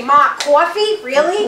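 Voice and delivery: put-on kids' teasing Long Island accent, loud; mocking Long Island accent. Kids making fun of their mother's accent, mocking Long Island. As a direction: tease Mom with an exaggerated Long Island accent. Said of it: "When my kids make fun of me".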